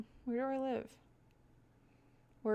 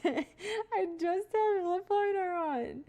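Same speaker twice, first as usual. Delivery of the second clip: higher, slow, laughing through the words; 8 semitones higher, much slower than usual. Real, not acted. Telling chat about her lip liner, laughing. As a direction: laugh it out at yourself.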